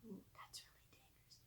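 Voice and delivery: a soft whisper of concern; very soft, breathy worried whisper; whispered aside. Real, not acted. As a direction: murmur a worried side remark under your breath. Reader's aside about electric fence.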